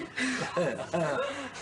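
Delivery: short amused laugh. Real, not acted. Speaker amused by own joke, laughing. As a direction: chuckle on after the quip.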